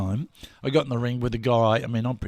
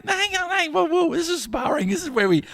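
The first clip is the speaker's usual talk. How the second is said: high, hurried mock panic; panicked protest; 11 semitones higher than usual. Sparring partner begging the father to stop, high and flustered. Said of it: "And I'm going".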